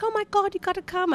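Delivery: high-pitched, badly faked English accent; terrible English accent. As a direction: do an excited Brit with a poor accent. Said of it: "I'm terrible at Eng-, English accent".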